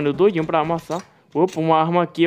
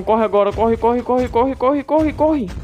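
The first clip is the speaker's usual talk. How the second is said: being chased; 8 semitones higher, slower than usual. Real, not acted. Fleeing, repeats run.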